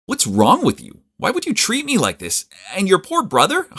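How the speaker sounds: high, loud furious accusation; angry confrontation. Acted. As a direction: confront her in outrage. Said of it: "I confronted her on the spot".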